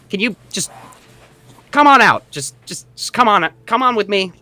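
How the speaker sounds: a small impatient jailer hurrying his prisoner; gnome voice. Acted. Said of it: "And he says".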